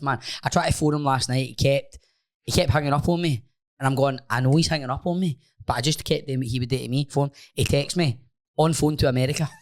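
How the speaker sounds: ordinary talk, nothing marked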